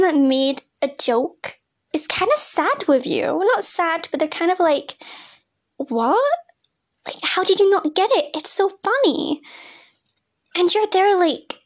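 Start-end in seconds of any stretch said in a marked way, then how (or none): 5.8-9.4 incredulous friend. Retold or imitated. Friend confused by missed joke.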